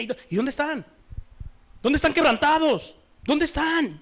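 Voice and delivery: loud, high fiery sermon; preaching at full force. Real, not acted. Preacher scolding the congregation, loud fiery preaching. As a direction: thunder it from the pulpit. Preacher demands to see the fruit.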